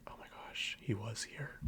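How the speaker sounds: hushed whisper of amazement. Retold or imitated. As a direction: breathe it out in awe. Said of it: "I'm like".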